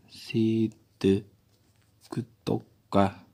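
very slow, low and soft; sounding out while writing. Real, not acted. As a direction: draw out each word as you write it. 글자를 쓰며 따라 읽음. (글씨 쓰듯 낮고 느리게 늘여 읽기)

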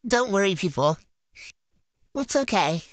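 usual speaking voice

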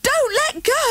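high alarmed plea; panicky child. A narrator's reading. Boy on wobbly bike pleading with his dad, high and panicky.

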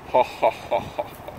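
a quiet sinister ha ha ha; mock evil laugh. Mock evil laugh after villain line.

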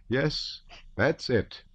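father moved by the manifesto, exclaiming high; cried out. A narrator's reading. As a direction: cry it out, eyes wet. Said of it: "cried the Count".

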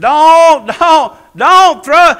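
loud, high, drawn-out plea; acted desperate cry; demons begging. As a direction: wail it slowly, pleading.

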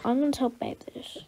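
hushed aside; a parent whispering a secret aside; whispered aside. Acted.

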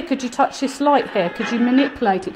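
usual speaking voice

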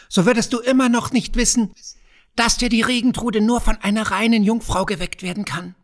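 a sneering kobold enjoying his secret; crafty goblin teasing the young farmer. A narrator's reading. Kobold reveals the condition.

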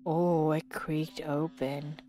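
slow frightened whisper; scared whisper. Real, not acted. Player creeping toward the creaking door, slow scared whisper. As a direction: hush it out, slow, afraid of the dark. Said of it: "I'm scared".

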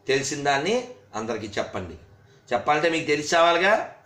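normal talking voice

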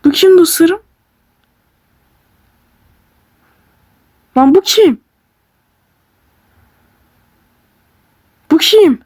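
loud startled yelling, high; shocked shout. Real, not acted. Gamer shouting at a creepy video in shock. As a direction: yell out in real shock at the screen.

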